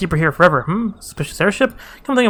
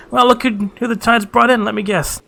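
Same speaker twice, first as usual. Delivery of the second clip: higher, teasing innkeeper voice; lightly voiced NPC; 7 semitones higher than usual. NPC greets the returning adventurer, teasing and higher. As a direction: tease the returning hero from up high.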